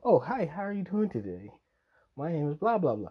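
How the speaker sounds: high, loud put-on chirpy greeting; hammed-up nice-to-meet-you voice; mock small talk. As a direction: play an exaggerated polite introduction. Acting out small talk with classmate.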